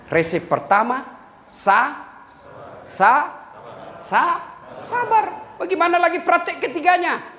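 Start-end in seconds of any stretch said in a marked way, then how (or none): 1.4-5.5 sa-, sa-, sa- climbing to sabar; stretched out sabar. Shown as a demonstration. Preacher teasing the word out for the audience, rising stutter. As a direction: build it syllable by syllable, rising high on sabar. Drawn out stepwise rise on sabar.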